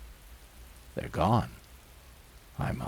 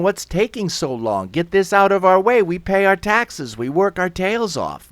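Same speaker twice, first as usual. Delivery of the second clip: raised, high, impatient crowd voice; angry shouting; 8 semitones higher, 6 dB louder than usual. Acted. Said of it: "They're shouting".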